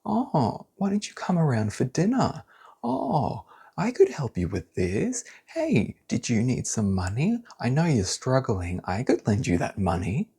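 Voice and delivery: high, sing-song fake kindness; mock the too-sweet helper; sugary hollow hospitality; fake generous offer. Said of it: "they make these offers like".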